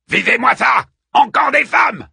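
loud, high jeering shout; filthy scavenger. A narrator's reading. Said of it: "dit le plus crasseux des charognards".

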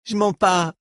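cri aigu, en se débattant; proteste, plus haut; protestation. A narrator's reading. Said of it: "qui se débattait toujours". (Reich secoué par Dors proteste)

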